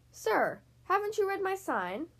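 high, indignant storybook shout. A narrator's reading. Mouse dentist to fox at the door, mild shout. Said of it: "Doctor DeSoto shouted".

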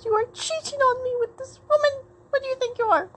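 high-pitched, hammed-up accusation; jealous lover. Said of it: "pretend to be his bad and bothered lover".

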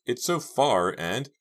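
high, slow, faltering; shaky voice. A narrator's reading. Girl daunted by the distance to the stairs, shaky. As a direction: draw it out anxiously, high. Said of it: "replied in a shaky voice".

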